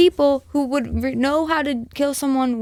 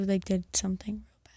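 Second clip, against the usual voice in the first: whisper; 2 semitones lower, 10 dB quieter than usual. Real, not acted. Dark question whispered aside.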